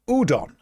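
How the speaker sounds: "Udon" as OO-don, slow and clear; Udon said clearly. Shown as a demonstration. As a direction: sound out "Udon" for a learner. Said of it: "Look at the letters".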